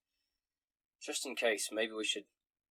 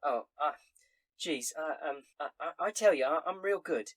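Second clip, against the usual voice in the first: put-on higher, stammering tavern girl; flustered barmaid; 5 semitones higher, 3 dB louder than usual.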